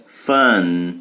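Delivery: "Fun" ending in an N said with a smile. Shown as a demonstration. Teacher telling students to smile on the N of "Fun". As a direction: smile as you finish "Fun".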